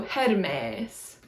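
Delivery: "Hermes" as her-MEHS, tapped r; short first e, long drawn second e in "Hermes"; Greek pronunciation. Shown as a demonstration. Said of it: "second E is a long E".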